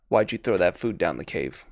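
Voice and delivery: lightly voiced creature, low and soft; psychic presence. Game master voices a presence inside a player's mind, lightly voiced creature. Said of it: "you feel this s-, uh, psychic presence".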